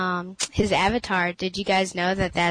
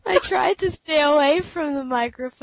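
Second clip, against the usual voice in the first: amused; 8 semitones higher than usual. Real, not acted. Still laughing after sneeze.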